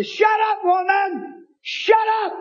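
high-pitched re-enacted holler; retold angry rebuke. Preacher retelling rebuke to hollering woman, high and exasperated.

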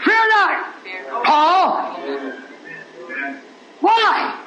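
high, ringing preacher's declaration; full-force preaching. Acted. Preacher declaring himself God's prophet to the congregation, full-force proclamation.

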